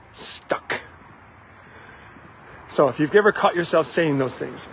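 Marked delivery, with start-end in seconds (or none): 0.1-0.8 breathy whispered stuck; a defeated whisper of stuck. Retold or imitated. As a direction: let the word fall to a whisper. Quoting weary self-talk.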